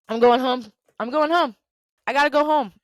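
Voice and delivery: high, loud, acted protest; upset walkout. Fed-up employee walking out on her bosses, upset.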